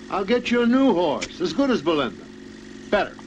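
eager reassurance. Acted. Father cheering up discouraged daughter.

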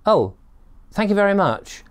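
high-pitched, mock-polite thanks; baffled politeness. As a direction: thank him as if you understood. Baffled thanks after incomprehensible directions.

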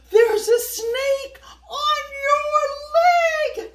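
high, loud, slow terrified shriek; a mother screaming in panic; frightened scream. Acted. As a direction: scream the warning at him. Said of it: "Missus Chow screamed".

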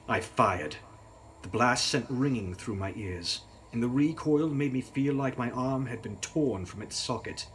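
plain reading voice

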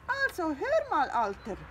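high, loud, indignant hen; huffy hen Brillan. Acted. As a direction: put the cat in his place. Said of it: "sagte das Huhn Brillan".

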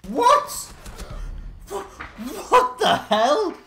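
high, loud outraged shouting; berserk rage. Real, not acted. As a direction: yell in furious disbelief. Shouting after being killed unfairly.

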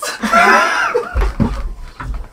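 shrieking high laugh. Real, not acted. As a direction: burst into laughter. Slap about to happen.

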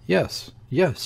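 quick, high, nervous yes. A narrator's reading. Wife to Ossipon, nervously insisting. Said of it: "she said nervously".

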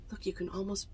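soft hushed whisper. Real, not acted. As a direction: whisper it like a secret. Hushed aside showing the picture.

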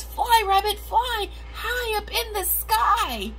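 high loud sing-song call. Acted. Grandma reading to grandchild, calling out high and loud. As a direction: cheer the rabbit up into the sky.

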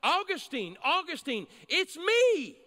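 high, loud call up to a window; shouting. Retold or imitated. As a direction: call out to him, last words stretched and loudest. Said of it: "and she shouts".

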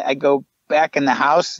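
ordinary talk

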